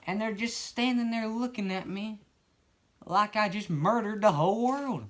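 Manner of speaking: high, slow put-on storyteller voice; character voice. Newcomer walking into the bloody store, high and slow. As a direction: stroll in slow and high, clueless. New narrator walks into store.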